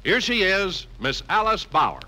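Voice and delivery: ordinary talk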